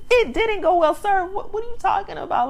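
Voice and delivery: very high-pitched, exasperated objection; amused incredulity. Retold or imitated. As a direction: set him straight in high-pitched mock disbelief. Scolds the man in disbelief.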